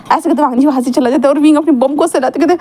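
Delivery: usual speaking voice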